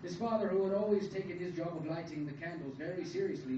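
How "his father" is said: ordinary delivery, nothing marked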